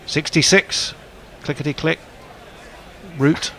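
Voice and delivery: sing-song number calling; bingo caller. Acted. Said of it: "Just laughing at your bingo calling".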